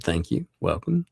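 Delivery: ordinary talk, nothing marked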